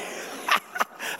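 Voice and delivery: soft voiceless chuckle. Real, not acted. Speaker amused by his dad's comeback, chuckling. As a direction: chuckle quietly at your own story. Laughs at own story.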